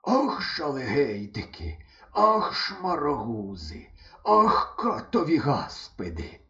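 дуже повільно, задихаючись; лайся, хекаючи; дід хекає. A narrator's reading. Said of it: "хекаючи і примовляючи". (дід Салимон хекає й лається)

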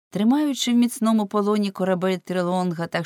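usual speaking voice

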